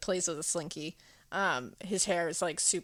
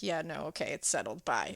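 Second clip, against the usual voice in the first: low brush-off; 5 semitones lower, 5 dB quieter than usual. Retold or imitated. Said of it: "he's like".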